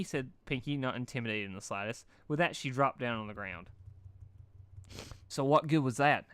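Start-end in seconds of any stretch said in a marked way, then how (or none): 5.3-6.3 cynical. A narrator's reading. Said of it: "asked Pinkamena cynically".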